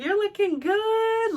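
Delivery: high, drawn-out whoop of praise; imitating a gym friend's high holler; imitated cheering. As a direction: cheer it out loud, stretching the words. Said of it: "she's like".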